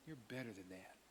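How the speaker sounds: soft, low repeat of the rebuke; quiet. Retold or imitated. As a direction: let it land softly. The quote repeated softly.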